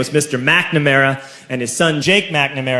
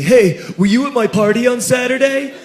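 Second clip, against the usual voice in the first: put-on higher-pitched teenage schoolmate asking; high, probing high-schooler question; Jake McNamara; 7 semitones higher than usual. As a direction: question a classmate in a raised kid's voice. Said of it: "he says to me".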